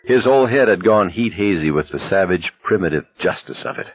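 usual speaking voice

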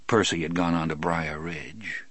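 usual speaking voice

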